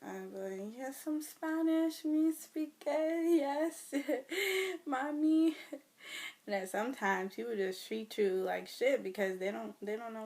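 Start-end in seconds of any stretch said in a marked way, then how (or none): 0.8-5.6 put-on Spanish accent. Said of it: "trying to pass for Spanish".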